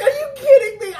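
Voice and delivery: high, loud disbelieving yell; shocked shout. Retold or imitated. Watching the episode, yelling at the screen in shock. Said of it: "that's what I was just like".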